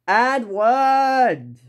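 high, drawn-out yell; acted outraged cry; yelling the name. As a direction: shout his name, stretching both syllables. Gordon yells at Edward.

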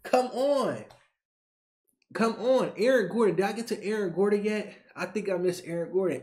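usual speaking voice

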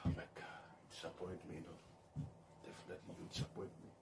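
breathy whisper, words barely sounded; disappointed whisper. Real, not acted. Sighing over Londo Fankaso letting him down, whispered. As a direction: let the disappointment drop to a whisper. Dismayed aside after sighing.

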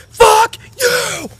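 dad screaming at the son who keeps asking; screamed curse. Retold or imitated. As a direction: explode it right at the son.

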